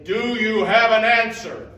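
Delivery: booming challenge from the knight; demanding loud question. Acted. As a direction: call it out loud and hard.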